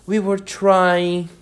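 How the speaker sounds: high, drawn-out pleading; very humble. A narrator's reading. Gardener humbly explains himself to the Queen. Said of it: "in a very humble tone".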